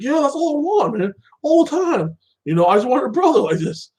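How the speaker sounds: high-pitched, mock-eager brother; eager acceptance. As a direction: imitate a brother gushing, pitch way up. Villain accepts brotherly offer.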